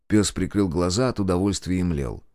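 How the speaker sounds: usual speaking voice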